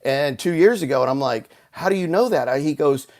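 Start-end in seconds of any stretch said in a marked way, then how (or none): 1.7-2.5 incredulous question. Retold or imitated. Retells his incredulous question to friend.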